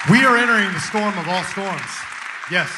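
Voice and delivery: loud, booming warning over applause; impassioned proclamation. Real, not acted. Speaker warning the crowd of the coming storm, loud proclamation.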